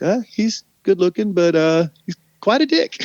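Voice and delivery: high, lightly voiced teasing girl; a hint of a girl dishing to her mom; wry daughter verdict. Haley telling her mother about the DJ, high wry quote. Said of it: "And he was".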